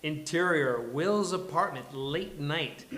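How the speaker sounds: loud, punchy stage directions; projected, big opening narration; announcer-style script reading. A narrator's reading. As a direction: kick off the show like an announcer. Opens the table read as narrator.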